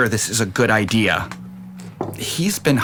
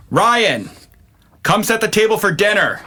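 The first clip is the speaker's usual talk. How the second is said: raised voice calling to a son upstairs; 10 semitones higher than usual. Acted. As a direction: call him down for dinner.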